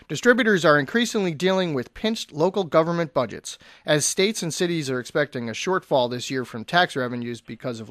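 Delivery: brisk radio news read. Acted. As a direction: rattle off the headlines. Quick news rundown on air.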